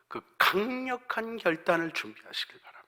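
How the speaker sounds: normal talking voice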